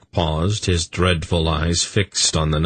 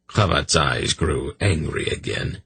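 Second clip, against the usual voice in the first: plain reading voice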